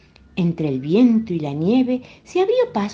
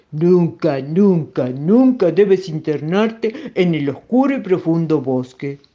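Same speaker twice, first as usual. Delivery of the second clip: loud, slow, booming warning; stern monster papa in a put-on voice; warning Gruffalo father; 6 dB louder, slower than usual. Gruffalo father warns his daughter.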